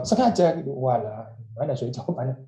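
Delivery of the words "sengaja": higher, sharp blunt reply; brothers' blunt answer. Pretend. Brother bluntly answering Joseph's hopeful question. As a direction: snap back the admission. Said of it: "kalau dijawab".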